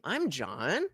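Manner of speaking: "John" swung upward like a question; upspeak on name. Shown as a demonstration. Streamer trying out people asking their name, upspeak on "John". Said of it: "when people use the upspeak thing".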